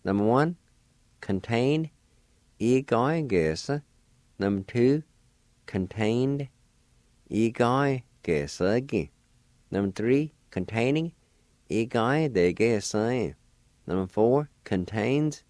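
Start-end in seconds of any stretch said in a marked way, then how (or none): 2.6-3.8 E-ga-e-ge-se spoken slowly and evenly; slow model reading. Shown as a demonstration. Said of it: "pronounce these words one more time". 7.3-9.1 E-ga-e-ge-se-gi spoken slowly and evenly; slow model reading. Shown as a demonstration. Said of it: "pronounce these words one more time". 11.7-13.3 E-ga-e-de-ge-se spoken slowly and evenly; slow model reading. Shown as a demonstration. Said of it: "pronounce these words one more time".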